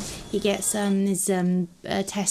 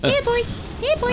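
calling a lost pet. Pretend. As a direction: call the animal over, pitched way up. Calling the pig over.

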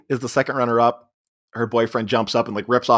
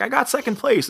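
high-pitched imitation of a proud girl; mock excitement; 12 semitones higher than usual. Leslie to her boyfriend about second place, mock excited.

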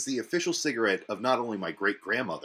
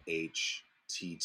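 slow spelling; 4 semitones lower, 9 dB quieter, slower than usual. Shown as a demonstration. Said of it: "so it's C Y H H T Y".

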